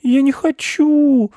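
very high, slow, loud whine; drawn-out squeaky child protest; about to bawl. A narrator's reading. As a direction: wail it like a girl about to cry. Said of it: "собираясь на всякий случай зареветь".

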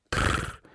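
short low rolled trill; a toneless rattle of the tongue; beetle trill. Acted. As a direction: buzz a low insect "trrr". Beetle answers.

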